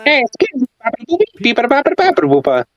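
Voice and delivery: very high cartoon Italian gibberish; mock Italian. Joking along in fake Italian, high mock accent. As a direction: babble in a hammed-up Italian accent. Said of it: "You can't speak Italian".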